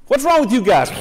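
harsh scolding God. Pretend. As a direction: berate them like a harsh God.

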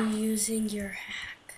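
suspicious whisper. Real, not acted. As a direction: whisper it accusingly. Suspicious question in game.

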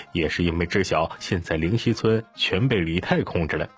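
ordinary delivery, nothing marked

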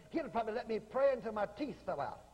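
ordinary talk

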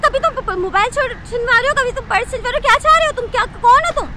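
angry outburst. Acted. Girl yelling at the thief's accomplice, high and furious. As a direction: blast him for tricking her. She finds he is in league.

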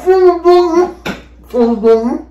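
mouth full. Real, not acted. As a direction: push the words past a crammed mouth. Mouth stuffed with marshmallows.

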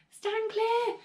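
high-pitched call to get back; excited, sustained high call; urgent warning. Acted. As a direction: warn bystanders off. Warning as Grandma may explode.

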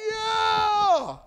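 high exultant yeah; leaving the retreat overjoyed; joyful yell. Retold or imitated. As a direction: burst out with joy. Retold joyful shout.

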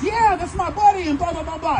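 high, loud chirpy reply; high girl voice. Retold or imitated. The girl answering about her buddy, high voice. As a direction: play her bright and high.

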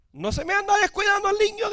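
high, loud scolding of a parent; scolding teacher. Retold or imitated. Teacher scolding a careless parent, high and loud. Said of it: "maestros reprendiendo padres".

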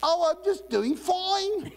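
very high, stretched-out mock South African accent. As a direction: answer in a hammed-up South African accent, slowly. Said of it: "with that accent".

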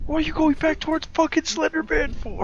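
yelling while running away. Real, not acted. Fleeing Slender Man, yelling at teammates.

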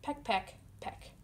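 whispered, soft peck sounds. Acted. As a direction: breathe it out like tiny pecks. Turkeys pecking sound.

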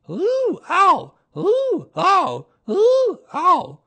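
loud, high bouncing ooh and ow; jolting run of high oohs and ows; alternating "ooh, ow". A narrator's reading. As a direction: squeal ooh going up, ow landing. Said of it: "And he was saying".

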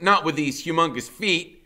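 usual speaking voice

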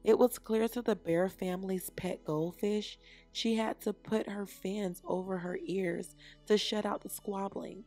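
ordinary delivery, nothing marked

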